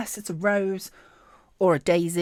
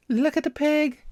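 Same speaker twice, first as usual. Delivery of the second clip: louder, higher thrilled exclamation; excited cry, higher and louder; 7 semitones higher, 8 dB louder than usual. Real, not acted. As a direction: say it out loud, thrilled. Repeats it aloud.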